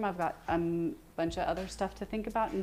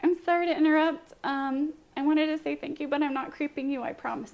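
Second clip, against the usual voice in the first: awkward apologetic message; 7 semitones higher than usual. Retold or imitated. Streamer imitating her awkward message to another streamer, hammed-up nervous. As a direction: fumble through an over-careful apology.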